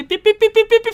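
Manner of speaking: high, loud rapid "pi pi pi" beeping; excited high-pitched mock radar; imitated radar beeps. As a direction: beep like an alarm going off.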